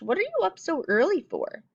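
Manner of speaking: high mock-surprised mom. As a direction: voice the puzzled mother, high. Mom voiced in story.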